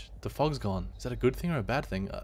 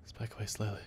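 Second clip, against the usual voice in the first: hushed; 2 semitones lower, 10 dB quieter, faster than usual. Real, not acted. Hiding from the monster.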